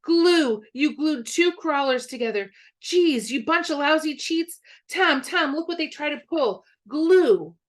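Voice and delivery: put-on a boy screaming that the worms were glued; outraged yell. A narrator's reading. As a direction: shout like you caught a cheat. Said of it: "screamed Billy all of a sudden".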